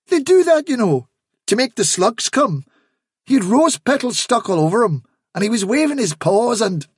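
high, eager rabbit rattling off testimony. A narrator's reading. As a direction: pile up the details keenly. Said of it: "said Hafsa eagerly".

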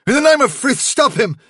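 high, panicked outcry; gasped plea. A narrator's reading. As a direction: cry for help in alarm. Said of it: "he gasped".

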